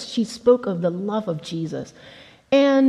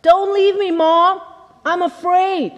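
put-on higher and louder, a scared little boy; frightened child crying out; 8 semitones higher, 4 dB louder than usual. A narrator's reading. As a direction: plead with mom, scared of the dark. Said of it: "her young son cried out".

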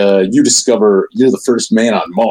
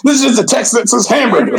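shouting; 12 semitones higher than usual. Real, not acted. Mock outrage at photo.

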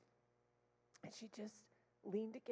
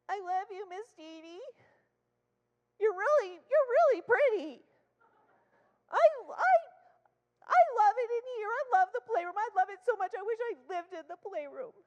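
put-on very high, loud little-girl gushing; imitated gushing child; 12 semitones higher, 15 dB louder than usual. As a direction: gush eagerly like a small child. Child gushes after earlier tantrum.

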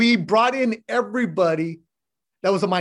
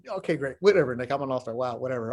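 lower, softer, mock-unimpressed mumble; unimpressed brush-off; 7 semitones lower, 4 dB quieter than usual. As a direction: wave him off, bored and deflated. Mock unimpressed reply to friend.

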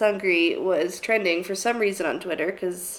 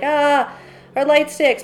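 mock dismay; 7 semitones higher, 7 dB louder, slower than usual. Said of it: "you're like".

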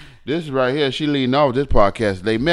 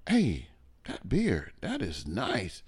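quiet; 7 semitones lower, 16 dB quieter, slower than usual. Retold or imitated. Quoting admirers of his beard.

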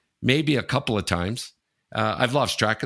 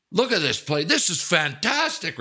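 raving; 6 semitones higher than usual. Retold or imitated. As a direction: gush over the place like it's a palace. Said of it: "this guy came in raving".

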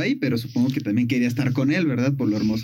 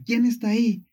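calling out; 6 semitones higher than usual. Retold or imitated. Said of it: "Narciso la escucha y dice".

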